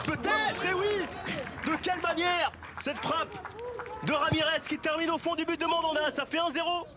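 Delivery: excited goal call. Real, not acted.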